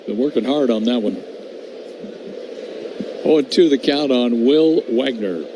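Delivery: ordinary talk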